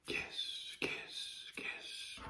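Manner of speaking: very soft whispered word, repeated; whispered repetition. Pretend. Putting Kiss in capital letters, very soft whisper. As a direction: whisper the name over and over.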